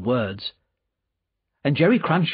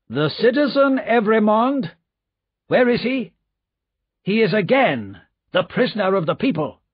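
stern demand; 10 semitones higher, 4 dB louder than usual. Acted. Men arrest Darnay at door.

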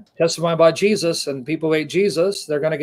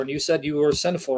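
ordinary talk, nothing marked

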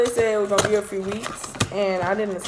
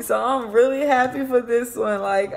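usual speaking voice

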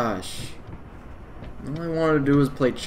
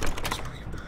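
trembling whisper; 6 dB quieter, much faster than usual. Real, not acted. Whispered plea.

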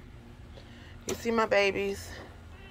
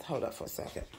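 whispered aside; 4 semitones lower, 15 dB quieter, faster than usual. Real, not acted. Aside while fiddling with table.

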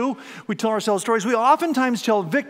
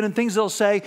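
usual speaking voice

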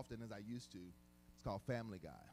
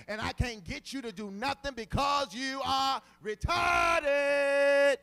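preaching at full force; 5 semitones higher, 15 dB louder than usual. Acted. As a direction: build the sermon to a roaring climax. Preaching builds to climax.

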